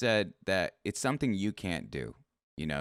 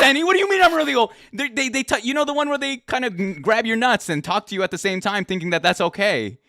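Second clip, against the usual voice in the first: indignant rant; 12 semitones higher, 8 dB louder than usual. Real, not acted. Indignant rant about age jab.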